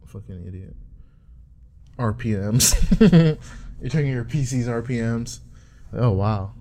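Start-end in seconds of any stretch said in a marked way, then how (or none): 2.8-3.7 laugh. Real, not acted. Laughing at own joke.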